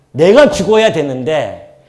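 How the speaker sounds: loud and slow, punching 내가; slow, loud stress on 내가; emphatic cry. Real, not acted. Preacher crying out his guilt to the congregation, loud and slow.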